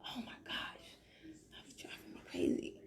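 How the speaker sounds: breathy relieved whisper. Real, not acted. Relieved aside to herself after the volume questions, whispered. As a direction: mutter it off-mic in relief.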